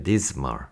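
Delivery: "dismal" with a voiced z for the s; Z sound. Shown as a demonstration. Teacher correcting the S, "dismal" said with Z. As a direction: buzz the s in "dismal", low-pitched. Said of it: "S 발음 아니고 Z 발음이에요".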